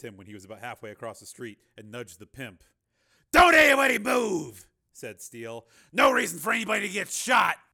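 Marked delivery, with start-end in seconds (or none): 3.3-4.7 high, loud hold-up shout; shouted command. Acted. Steele holding up the pimp at gunpoint, shouted command. 5.9-7.6 high, loud, forceful warning; shouted warning. Acted. Steele warning the group at gunpoint, loud threat.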